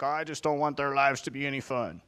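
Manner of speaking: put-on Southern accent for God. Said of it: "I had a southern accent for God".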